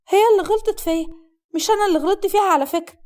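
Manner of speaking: put-on high-pitched protesting young woman; defensive young bride.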